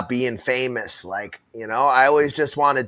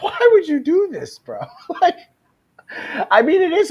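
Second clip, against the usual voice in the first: laughing; 6 dB louder than usual. Real, not acted. Reacting to clip laughing.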